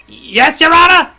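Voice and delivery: high, loud, startled yes to the judge; flustered shout. Acted. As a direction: snap to attention, panicked. Prosecutor answers judge, flustered.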